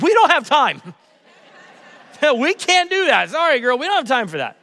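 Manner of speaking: hammed-up dismissive father; mock brusque dad. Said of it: "I looked at Brinley and I said".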